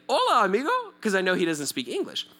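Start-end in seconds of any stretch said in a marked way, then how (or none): 0.1-0.7 high, lifted hail; hearty greeting. Retold or imitated. Walking up to the praying Korean man, hearty greeting. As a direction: call it out brightly as a greeting. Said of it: "I walk up to him and say".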